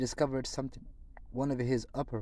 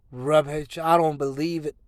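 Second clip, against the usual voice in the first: scoffing; 4 semitones higher, 10 dB louder than usual. Acted. Aunt dismisses news.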